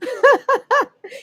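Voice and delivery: high peal of laughter. Shown as a demonstration. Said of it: "laughing as you go".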